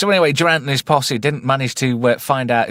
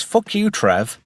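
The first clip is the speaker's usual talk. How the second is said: mock YouTube voice; 10 semitones higher, slower than usual. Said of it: "and it was".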